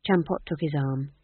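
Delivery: ordinary delivery, nothing marked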